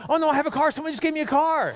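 high-pitched, elated announcement; excited intern. Retold or imitated. Intern to the staff who just gave away a car, excited. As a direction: imitate someone thrilled at unexpected luck.

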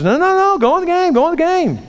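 very high, insistent repeated order; excited urging. Retold or imitated. Coach sends Jack back onto the field, excited urging. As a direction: send him back in with a high-pitched cry.

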